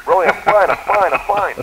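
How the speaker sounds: high, loud insisting he's fine; panicky insisting. Retold or imitated. Knocked-out stuntman telling Stallone he's fine, high and loud. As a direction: push it up high and loud, brushing off the injury.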